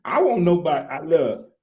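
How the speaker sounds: put-on high-pitched country boy; country boy character. Guest dodges questions as the country boy, in character.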